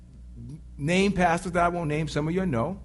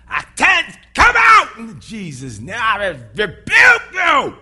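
loud, slow drawn-out rebuke; hammed-up deliverance shouting; mock rebuking shout; 8 dB louder, slower than usual. Preacher imitating church folk rebuking devils, slow loud shout.

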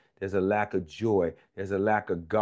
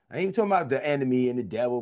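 ordinary talk

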